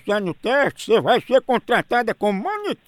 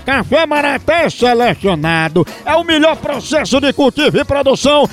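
ad read; 4 semitones higher, 5 dB louder than usual. Acted. Host reads coffee ad.

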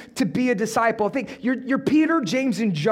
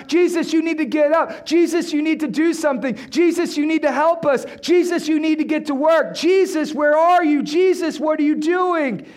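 frantic demands; 6 semitones higher, 4 dB louder than usual. Acted. Disciples demanding Jesus wake.

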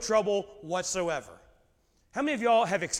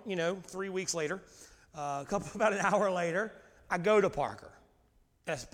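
normal talking voice

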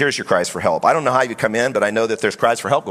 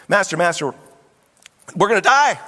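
mock panic rising high; scared fishermen; 8 semitones higher than usual. Fishermen calling Jesus in the storm, acted fright. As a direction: build to a high cry of alarm. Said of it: "these seasoned fishermen are really scared".

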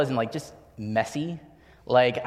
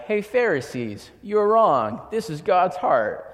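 slow, louder, higher rebuke; mock scolding Jesus; 6 semitones higher, 5 dB louder, slower than usual. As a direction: scold them like a blunt teacher. Jesus imagined scolding the Pharisees.

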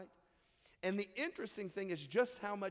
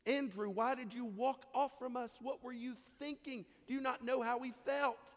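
scolding parent; 6 semitones higher than usual. Retold or imitated. Modern parent scolding lost son.

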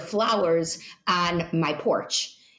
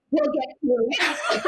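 laughing through a quote; 8 semitones higher, slower than usual. Retold or imitated.

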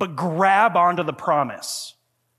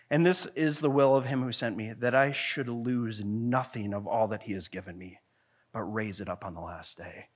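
sinking steadily lower and softer; hushed scripture reading; 8 semitones lower, 6 dB quieter than usual. Real, not acted. Preacher reading Jesus' promise to the congregation, growing hushed. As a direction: let the verse settle down to a near hush.

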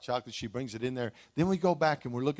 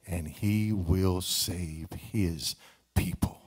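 lower, earnest prayer voice; 4 semitones lower than usual. Acted. Pastor leads congregation in worship prayer. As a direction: pray it over the room.

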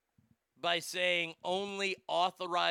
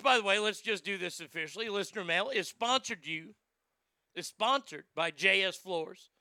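ordinary talk, nothing marked

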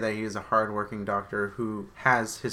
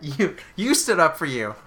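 mock dramatic reveal; 6 semitones higher, 7 dB louder than usual. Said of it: "say it like that".

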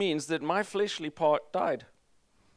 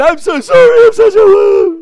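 very loud, high hammed-up wailing; mock-sob the apology; mock crying prayer; 21 dB louder than usual. A worshipper sobbing in prayer, hammed-up wail. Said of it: "pray like this".

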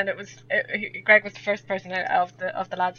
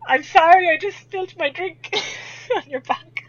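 flustered apology; 9 semitones higher, 6 dB louder than usual. Retold or imitated. Apologising for spilled drink.

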